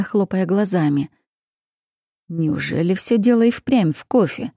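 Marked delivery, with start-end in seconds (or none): none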